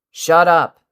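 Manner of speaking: sharp loud yell. A narrator's reading. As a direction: snap it out as a full yell. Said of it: "Kacchan yelled".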